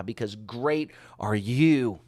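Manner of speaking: slow, weighty "great are you"; emphatic. Acted. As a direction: lean hard on "great" and "you", stretching both.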